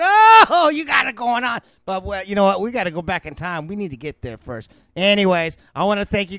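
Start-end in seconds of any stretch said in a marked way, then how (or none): 0.0-1.6 high, loud whoop of delight; excited cheer. Acted. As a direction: burst out with a big thrilled "oh". Imagined delight at waking to pork.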